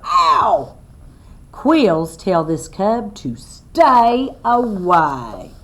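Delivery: slow, loud read-aloud to a class; reading to children. Acted. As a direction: spell it out clearly for little listeners. Reads book text aloud.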